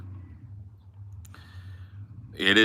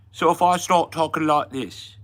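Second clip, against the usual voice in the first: high, loud mock Cockney; Cockney accent; 8 semitones higher, 3 dB louder than usual. Shown as a demonstration. Showing Americans his British accent, Cockney. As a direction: lay on a stagey Cockney accent. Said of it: "Did you want a Cockney accent?".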